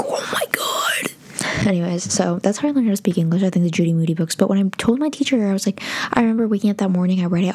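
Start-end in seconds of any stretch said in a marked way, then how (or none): 0.0-1.7 breathless, slow awed whisper. Retold or imitated. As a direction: murmurer lentement « Oh my God » comme ébloui.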